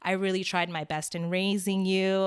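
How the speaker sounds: usual speaking voice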